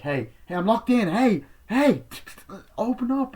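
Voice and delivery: high, loud shouts to be let out; acted-out trapped heroine; panicked calling out. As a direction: shout through a locked door. Acting locked-in girl calling out.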